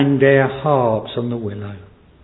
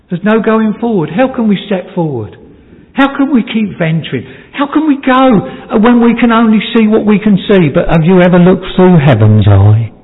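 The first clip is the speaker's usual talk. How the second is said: higher preaching voice, building louder; impassioned preaching; 7 semitones higher, 5 dB louder than usual. Acted. Preacher asks congregation how we can step forward, impassioned preaching. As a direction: build in pitch and force like a preacher.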